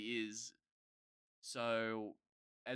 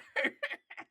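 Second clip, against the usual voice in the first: unvoiced high giggle; airy laugh; 5 dB louder than usual. Real, not acted. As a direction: breathe out a laugh.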